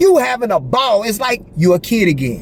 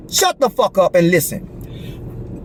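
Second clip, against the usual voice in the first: voice of God; 3 dB quieter, slower than usual. Retold or imitated. God's voice telling him off.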